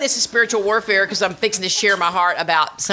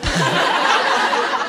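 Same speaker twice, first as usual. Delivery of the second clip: a long high bright burst of laughter; real giggle breaking out. Real, not acted. Laughs after the joke.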